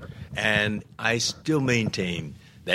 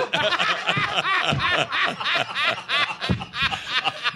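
high burst of laughter; hearty laugh. Real, not acted. Big laugh at joke.

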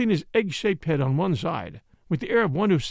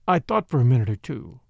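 plain reading voice

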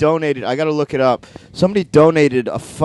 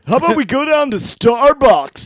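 much higher, louder bro voice; 10 semitones higher, 5 dB louder than usual. Acted. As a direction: sound like a frat bro.

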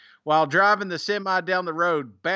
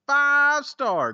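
high, stretched announcer flourish; hyped-up score call; rating fanfare; 8 semitones higher, 3 dB louder than usual. Real, not acted. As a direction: sing out the rating with a long first word.

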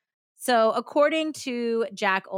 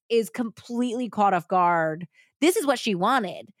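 normal talking voice

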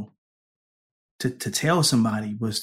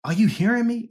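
impatient counselor; 10 semitones higher than usual. Retold or imitated. Retelling the college counselor checking he's listening, in a raised mock voice. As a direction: pitch it up like an impatient counselor asking if you're listening. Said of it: "what he said was like".

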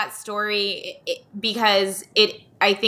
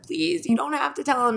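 put-on higher-pitched child's plea; mock pleading, pitched up; imitated begging; 7 semitones higher, 3 dB quieter than usual. Child begging mom not to tell dad, imitated pleading. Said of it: "begged her".

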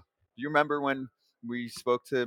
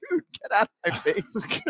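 much higher, dismissive brush-off; higher mocking Burnett impression; 11 semitones higher than usual. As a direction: swat him away with a high voice. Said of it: "Burnett's like".

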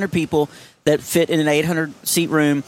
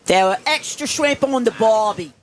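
Australian accent; 7 semitones higher than usual. Shown as a demonstration. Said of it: "Bob, you go first".

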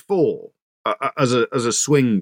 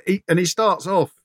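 ordinary talk, nothing marked

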